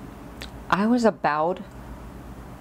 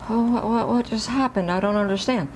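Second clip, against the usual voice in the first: higher, lightly acted confusion; mildly bewildered; 6 semitones higher than usual. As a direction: sound a little lost, imitating a puzzled child. Retold bewilderment as doctor leaves.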